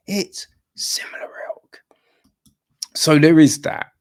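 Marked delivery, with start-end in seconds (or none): 0.1-1.8 whispered aside. Real, not acted.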